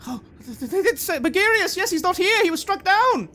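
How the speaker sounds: high, loud nervous agreement; a follower scrambling to confirm; flustered cultist. Acted. Cultist voice reacts.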